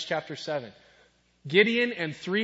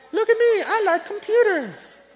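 much higher, louder nerdy squeak; nerdy Bill Gates; 6 dB louder than usual. Retold or imitated. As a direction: play the geeky young programmer showing off. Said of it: "Look at that nerdy guy".

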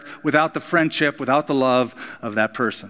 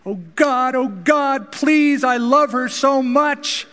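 desperate prayer; 11 semitones higher, slower than usual. Retold or imitated. Luther praying over his dying daughter, high and pleading. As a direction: beg God through tears for a dying child.

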